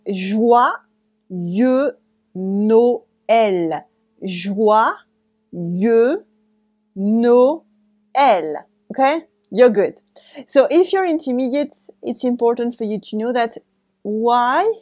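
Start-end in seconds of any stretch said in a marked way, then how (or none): 0.1-8.7 slow, split Joyeux and Noël with long pauses; slow syllable by syllable. Shown as a demonstration. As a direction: spell it out slowly, letting each word land. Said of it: "let's take some time to pronounce it well for beginners".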